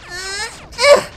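high, loud whimpering effort noise; mock straining squeal; pathetic grunt. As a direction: imitate a feeble punching grunt. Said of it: "most, like, pathetic sound".

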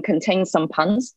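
ordinary talk

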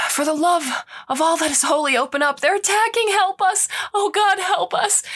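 frantic cry for rescue, voice cracking upward; desperate shout. Acted. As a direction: scream for help through a door. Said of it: "a shout through the door".

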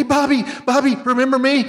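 high, far-off calling from the balcony; Rickles calling out. Retold or imitated. Rickles calling to De Niro from the balcony, high. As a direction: shout up like a forgotten fan in the cheap seats. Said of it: "And he says".

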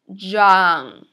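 put-on loud, low YOUNG with a long vowel; model pronunciation. Shown as a demonstration. Teacher has students repeat after her, drawn-out model pronunciation. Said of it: "Repeat after me".

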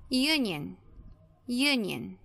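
"Union" starting with a consonant Y, "yoo-"; initial Y sound. Shown as a demonstration. Teacher shows why it takes "a", Y sound starting "Union". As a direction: open "union" on a clear "yoo" glide. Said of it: "o mesmo som da letra Y".

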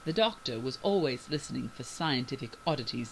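usual speaking voice

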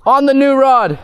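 "On the new rod" called high and loud; fighting a fish. Real, not acted. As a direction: yell "On the new rod" in excitement.

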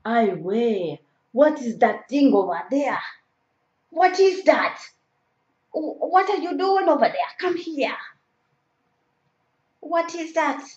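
put-on high, alarmed African-accented calls. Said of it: "doing an African accent".

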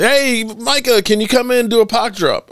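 high-pitched hollered request, imitated; wołanie do zawodnika. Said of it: "they were like".

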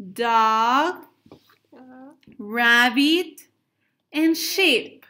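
loud, clear words for repeating; teacher modelling vocabulary loudly; clear model words. Shown as a demonstration. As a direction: call each word out for the class to copy. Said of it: "please repeat with me the words".

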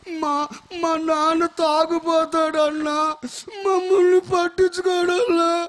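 slow, tearful plea, voice cracking into a sob; crying girl. Retold or imitated. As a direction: retell a little girl's crying complaint. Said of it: "అని ఏడుస్తుంటే".

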